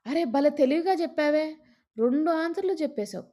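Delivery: delighted praise. Acted. Young man praises clever girl.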